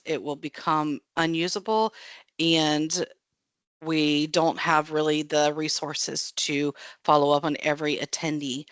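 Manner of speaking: ordinary talk, nothing marked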